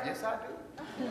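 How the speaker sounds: quiet and slow, with a laugh; soft laughing admission. Acted. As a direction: confess it softly, amused. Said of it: "you talk to yourself".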